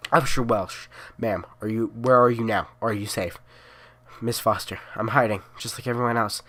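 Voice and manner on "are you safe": usual speaking voice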